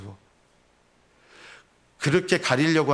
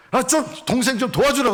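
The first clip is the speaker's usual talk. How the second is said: much higher, louder reproach; scolding Martha; 10 semitones higher, 3 dB louder than usual. Retold or imitated. Martha reproaching Jesus about her sister. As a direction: nag Jesus sharply. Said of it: "예수님께 타박을 합니다".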